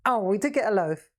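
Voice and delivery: usual speaking voice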